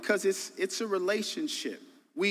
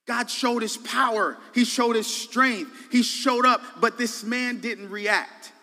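louder, higher preaching climb; preaching at full force; 6 semitones higher, 5 dB louder than usual. Real, not acted. Preacher builds to the climax before the church, full force. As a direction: drive it home to the congregation.

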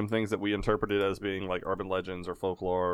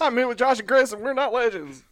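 mocking imitation; 13 semitones higher, 4 dB louder than usual. Mimics S1's line just said.

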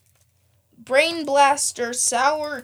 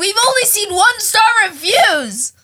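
loud high exclamation; outraged shout; 9 semitones higher, 11 dB louder than usual. Real, not acted. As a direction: shout it in disbelief. Outraged at one-star reviews.

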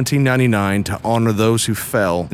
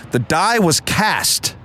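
dramatic reading; 6 semitones higher, much slower than usual. Acted. Reading an exclaimed inscription line.

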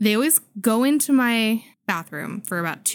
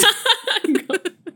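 giggling high after the joke; high giggle; 12 semitones higher, 3 dB louder than usual. Real, not acted. Laughs after child's line.

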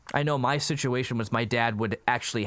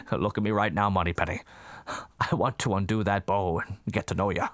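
low, smooth spy come-on; suave spy voice; 4 semitones lower than usual. Retold or imitated. Retelling the interview, a low suave spy voice. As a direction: purr it like a movie spy. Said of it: "montage of him just being Robin Williams".

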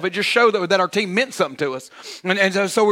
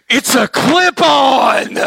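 shouted mocking jeer from the crowd, high and slow; heckler yelling; 7 semitones higher, 6 dB louder, much slower than usual. One guy in the crowd yells at the freshman, loud jeering shout. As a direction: bellow it out like a heckler in the stands. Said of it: "he goes".

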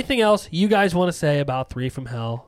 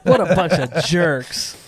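laughing; 5 dB louder, slower than usual. Real, not acted. Laughing at co-hosts' prank.

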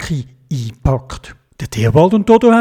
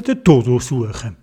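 usual speaking voice

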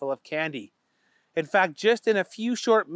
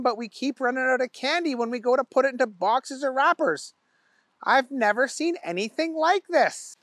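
a raised, lightly voiced worker; baffled worker explaining to the boss; higher worried worker voice; puzzled employee; 7 semitones higher than usual. A narrator's reading.

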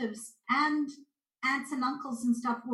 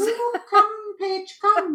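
high, loud beckoning call; hills calling; 10 semitones higher, 7 dB louder, much slower than usual. Pretend. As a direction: playfully imitate hills calling someone over. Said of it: "I know what the European hills are calling me".

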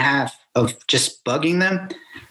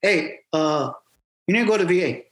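lightly imitated accent of a fellow Marine; a touch of his accent, raised in pitch; Hernandez; 4 semitones higher than usual. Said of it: "in his accent".

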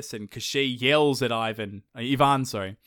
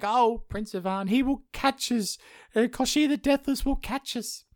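princess voice; 11 semitones higher than usual. Retold or imitated. Maya Marevna frets to Ivan in a high damsel voice. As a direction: play the fretting princess, pitched way up.

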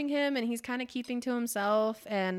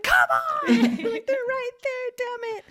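much higher, loud laughing yell of frustration; frustrated shout; 9 dB louder than usual. Retold or imitated. Listener in the car yelling at the characters, loud and laughing. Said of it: "I was like".

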